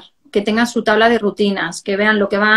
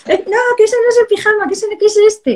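much higher, comic playful voice; silly voice; 12 semitones higher, 4 dB louder than usual. Retold or imitated. Mother teasing her naked son, much higher silly voice. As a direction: tease him with a squeaky silly voice. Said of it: "muy gracioso con mi hijo".

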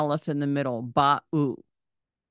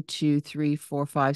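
usual speaking voice

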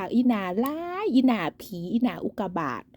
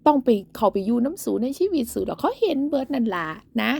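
ordinary delivery

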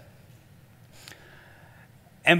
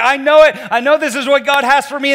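higher, louder, sure of the dream; confident excitement; 8 semitones higher, 7 dB louder than usual. Retold or imitated. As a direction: proclaim it like it's certain. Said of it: "you're like".

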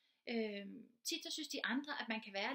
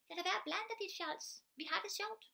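put-on higher, snappy girl's retort; annoyed girl; 7 semitones higher than usual. Teacher voicing the girl brushing off the classmate, higher snappy retort. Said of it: "Og så siger pigen".

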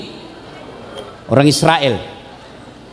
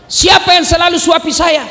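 blind man demanding; 11 semitones higher than usual. Retold or imitated. Blind man asking who always fed him, raised and urgent. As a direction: demand to know who it was.

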